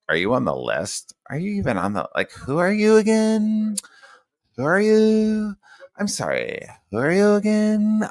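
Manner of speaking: exaggerated camp voice, stretched vowels; mock camp gatekeeper. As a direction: imitate a snooty check-in guy, swooping up high.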